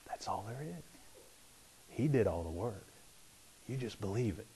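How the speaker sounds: quiet unvoiced whisper; whispering back the simple answer; whispered reply. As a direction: answer in a hush, gently. Pastor answers the whispered question.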